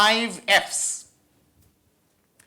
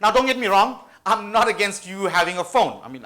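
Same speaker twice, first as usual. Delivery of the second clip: ordinary talk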